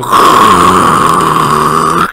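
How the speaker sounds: very loud, sustained dryer whoosh; imitated dryer noise. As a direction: blast out a long rushing noise. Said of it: "just getting the sound of".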